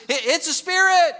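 high, loud startled cry; crying out in fear. Acted. Frightened disciples spot a figure on the sea, crying out. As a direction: cry it out in alarm. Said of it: "they cried out and says".

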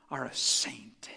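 slow, hushed, low whisper. Real, not acted. Pastor whispers to the congregation they are saints. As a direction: lean in and breathe it slowly.

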